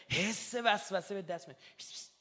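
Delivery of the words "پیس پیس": furtive whispered "psst, psst"; hushed, voiceless little hiss; sneaky whisper. Shown as a demonstration. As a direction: whisper it like a sly, secret temptation.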